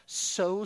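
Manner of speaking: "so" lifted high and loud, held apart; single out "so" as the key word; stress on so. Shown as a demonstration. Preacher isolating one word of John three sixteen.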